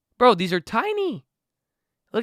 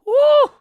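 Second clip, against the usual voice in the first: loud, much higher cheering whoop; excited whoop; 8 dB louder than usual. Real, not acted. Vlogger celebrating his growing stock account, loud whoop. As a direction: let out a big joyful whoop.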